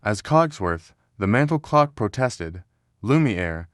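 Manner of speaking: ordinary delivery, nothing marked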